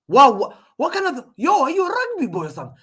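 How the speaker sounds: high, loud, then fast disbelief; shocked fan at a huge throw; incredulous shout. Real, not acted. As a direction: blurt it out in disbelief.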